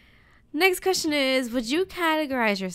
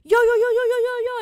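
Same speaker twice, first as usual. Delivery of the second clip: high, loud mock party yelling; rowdy neighbors; 10 dB louder, faster than usual. Imitating noisy neighbors.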